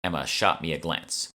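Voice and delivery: plain reading voice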